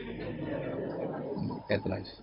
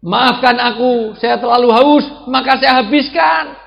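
pleading apology; 9 semitones higher, 9 dB louder than usual. Retold or imitated. The Prophet calls out to his wife Humairo, high and loud. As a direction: beg forgiveness at the top of the voice. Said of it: "Ya Humairo".

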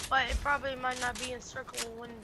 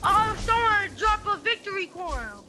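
excited; 8 semitones higher than usual. Real, not acted. Finds rare loot.

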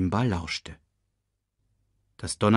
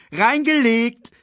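gleeful high, drawn-out crow; high-spirited shout; 7 dB louder, slower than usual. A narrator's reading. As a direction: crow over your successful prank. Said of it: "rief sie übermütig".

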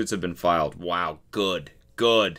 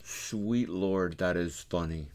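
usual speaking voice

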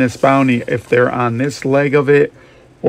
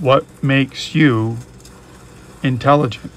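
drawn-out "what makes you", pause, then "intelligent"; slow right down and pause before "intelligent"; deliberate question, a long gap before "intelligent"; slow, pondering repeat; slower than usual. Real, not acted. Question repeated slowly for thought.

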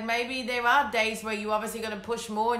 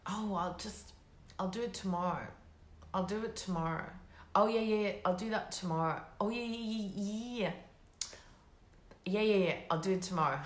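low, very soft brush-off; offhand putting-off; 3 semitones lower, 8 dB quieter than usual. Acted. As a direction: murmur it away, lower and much softer, as an imitated procrastinator. Acting out putting work off.

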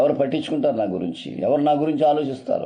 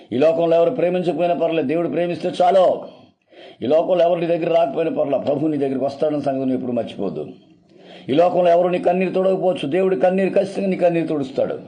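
preaching at full force; 4 semitones higher than usual. Real, not acted. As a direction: lift the voice to a rousing pulpit peak. Preacher builds to a climax. (ఉత్సాహంగా గట్టిగా ప్రసంగించడం)

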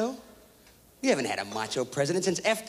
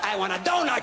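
ordinary talk

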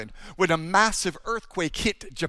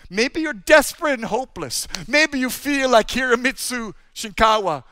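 loud, high, ringing sermon proclamation; full-force preaching; 5 semitones higher, 9 dB louder than usual. Acted. Preacher declaring God's names to the congregation, loud and soaring. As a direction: preach it out to the whole hall with power.

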